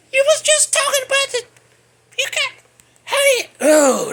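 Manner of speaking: outraged. Real, not acted. Outraged at hypocrisy.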